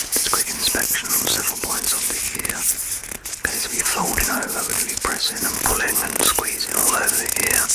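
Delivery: soft, close whispered ear exam; ASMR whisper. Acted. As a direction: whisper gently as you check the ear. Ear inspection roleplay whispered.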